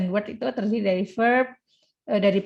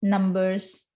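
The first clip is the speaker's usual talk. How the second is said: ordinary talk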